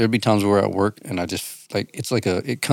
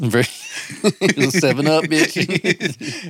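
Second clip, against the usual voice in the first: high, drawn-out, through laughter; laughing mock order; 8 semitones higher, much slower than usual. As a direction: crack up while playing the bossy home healer. Joking about home remedies, laughing.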